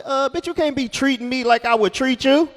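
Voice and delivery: high, loud mock outrage. Comedian scolding his date, mock outrage. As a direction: scold her in fake outrage. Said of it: "I was like".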